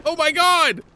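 high, loud mock-horror yell; horrified shout. Player horrified at friend's injury, hammed-up shout. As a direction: cry out in exaggerated shock.

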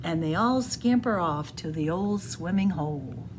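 plain reading voice

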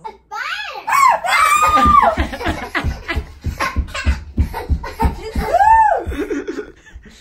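shrieking burst of laughter; screaming laugh. Real, not acted. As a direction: howl with laughter. Prank succeeds, wild laughter.

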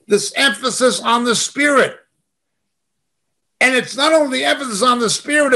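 ordinary talk

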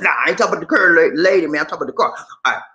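ordinary talk, nothing marked

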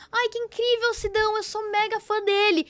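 high-pitched gushing; retold squeaky enthusiasm; excited fan reply. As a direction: gush like a thrilled fangirl. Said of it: "Aí eu falei assim".

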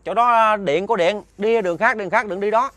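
high, loud urgent calling out; retold warning shout. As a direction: act out yelling a frantic warning to someone in the distance. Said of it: "Ổng la kêu bằng muốn khàn cổ luôn".

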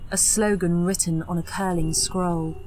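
plain reading voice

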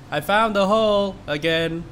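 ordinary delivery

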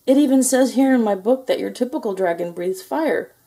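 high-pitched complaint; whiny moan of a let-down little knight. A narrator's reading. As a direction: protest in a high, plaintive knight's voice. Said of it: "Sir George moaned".

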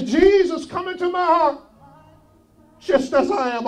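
loud, high cry of a prayer. Acted. Pastor voicing the sinner's prayer for listeners, loud and high. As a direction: cry it out to Jesus.

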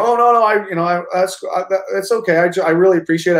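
acted gracious acceptance of bad news; polite brush-off. Politely taking bad news on call.

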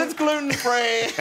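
high-pitched Californian accent, hammed up; Californian little girl. Dad imitating his health-fad daughter, high Californian accent. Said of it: "she's the most Californian".

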